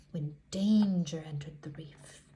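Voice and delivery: whispered suspense; hushed. A narrator's reading. Narrator hushes the children before the shark comes, whispering. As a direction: whisper it to build dread.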